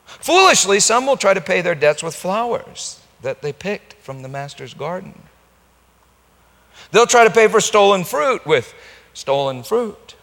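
loud, high preaching cry; impassioned preaching. Acted. Preacher warning fools paying with stolen fruit, crying out.

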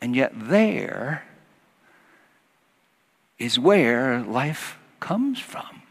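slow, soft whispered wonder; slow, whispered reverence; hushed awe. Real, not acted. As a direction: let it fall to a whisper. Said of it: "hushed awe".

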